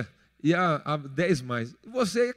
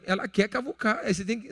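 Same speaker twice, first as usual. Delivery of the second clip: usual speaking voice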